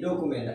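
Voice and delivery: "ডকুমেন্ট" said as "do-ku-ment"; common mispronunciation. Shown as a demonstration. Teacher showing what we usually say, "ডকুমেন্ট" as do-ku-ment. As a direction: say "ডকুমেন্ট" with a plain "ku", no y glide. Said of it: "আমরা এটাকে বলি".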